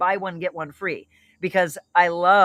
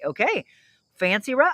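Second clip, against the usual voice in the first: normal talking voice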